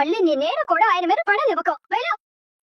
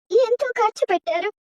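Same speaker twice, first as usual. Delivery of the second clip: normal talking voice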